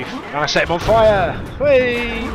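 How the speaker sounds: slow triumphant shout, then high whoop; gleeful cheer. Real, not acted. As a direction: celebrate the kill out loud. Gleeful kill celebration.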